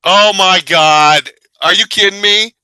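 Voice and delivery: loud, drawn-out astonishment; bowled over by a revelation; amazed. Real, not acted. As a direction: stretch it out loud, then pitch up in disbelief. Reacting amazed to co-host's explanation.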